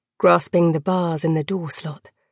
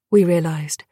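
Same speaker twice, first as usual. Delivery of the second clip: ordinary delivery, nothing marked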